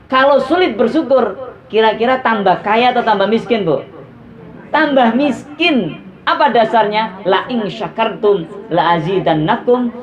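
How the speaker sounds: high-pitched, emphatic sermon delivery; forceful preaching. Real, not acted. Preacher warning mothers about ingratitude, forceful preaching. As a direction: drive the warning home, stretching key phrases slowly.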